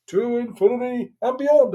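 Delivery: high, slow heroic toy voice; hammed-up space hero line; Buzz Lightyear catchphrase. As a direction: boom it out like a space ranger toy. Wife voices Buzz on phone.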